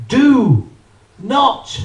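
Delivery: loud, slow reading of the label; mock solemn warning. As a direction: boom out the warning like an official notice.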